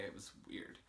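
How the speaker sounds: breathy whisper, almost to herself; softly confiding whisper; whispered aside. Real, not acted. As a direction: drop to a hush. Quiet aside about feeling used.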